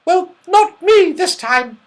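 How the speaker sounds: put-on very high and loud little girl; a cheeky little girl denying it; little Goldilocks. A narrator's reading. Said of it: "said Goldilocks".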